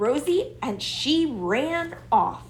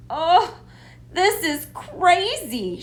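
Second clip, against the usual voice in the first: groaning; 8 semitones higher than usual. A narrator's reading. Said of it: "Rosie groaned".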